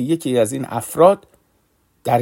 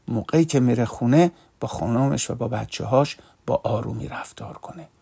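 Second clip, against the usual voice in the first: hushed prayer; 4 semitones lower, 6 dB quieter than usual. Acted. Whispered prayer for the man.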